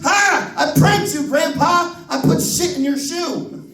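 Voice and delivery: put-on high, proud toddler crowing; gleeful little kid. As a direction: kid bragging about his prank.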